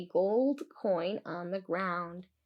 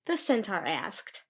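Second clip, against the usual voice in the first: ordinary delivery, nothing marked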